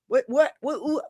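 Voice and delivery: ordinary delivery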